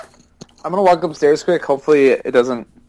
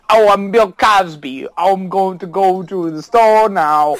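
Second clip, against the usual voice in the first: slow, higher-pitched celebrity impression; bad Bill Cosby; 3 semitones higher, slower than usual. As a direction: drag out a deliberately lousy comedian impersonation. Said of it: "doing a bad Bill Cosby".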